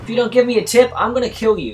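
hammed-up angry threat; mock threat. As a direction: yell a joking threat. Threatening taxi passenger.

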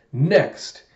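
imperious acted order; villain's command. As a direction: call for the next one like a gloating villain. Villain orders next target.

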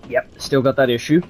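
talking through a blocked nose; stuffy, congested voice. Real, not acted. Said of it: "stuffy-nosed and sneezing all the time".

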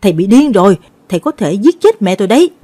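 loud, alarmed protest; acted outraged shout. A narrator's reading. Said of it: "hét lên".

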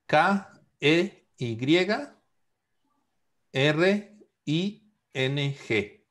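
slow letter-by-letter spelling of "K E Y R I N G". Shown as a demonstration. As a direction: spell "K E Y R I N G" slowly, one letter at a time.

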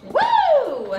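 high loud party whoop; excited whoop. Real, not acted. Cheering, drinking tequila.

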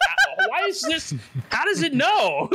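puzzled laughing question; confused high voice. Retold or imitated. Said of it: "I'm like".

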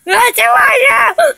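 loud alarm rising to a high cry; play panic. Pretend. Player facing a giant puppy monkey baby, mounting panic. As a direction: build from alarm to a frantic high yell. Giant monster appears in game.

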